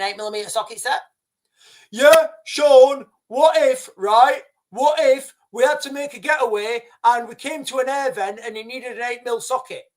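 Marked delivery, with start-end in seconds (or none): 1.9-9.9 higher, louder whiny mock of a critic; mocking commenter. Vlogger mocking a viewer's objection in a whiny put-on voice. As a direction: send up the nitpicker's objection.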